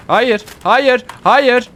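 high, loud repeated "no"; urgent high shouts; panicked shouting. Real, not acted. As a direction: cry out in alarm. About to fall in game.

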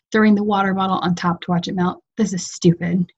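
plain reading voice

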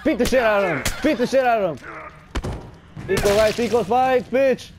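high, loud cheering as he swings; loud aggressive yell at a game enemy; fierce battle yell. Real, not acted. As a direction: bellow it while smashing the enemy.

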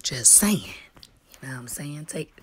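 very soft, confidential murmur; hushed aside, as if keeping it secret; whispering. Real, not acted. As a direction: drop to a near whisper. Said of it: "I don't know who I'm whispering for".

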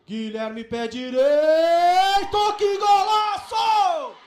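long drawn-out golaço shout; explosive goal commentary. Real, not acted. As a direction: belt out the goal call. Free-kick goal.

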